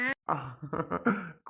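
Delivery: amused chuckling; laughing. Acted. Father asked to answer seriously, still laughing. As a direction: keep chuckling instead of answering. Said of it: "சிரிக்காம சொல்லுங்க".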